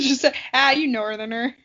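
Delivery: usual speaking voice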